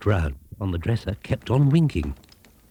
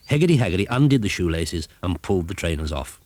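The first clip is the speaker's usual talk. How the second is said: plain reading voice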